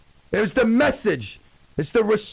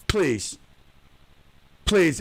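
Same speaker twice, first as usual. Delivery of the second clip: usual speaking voice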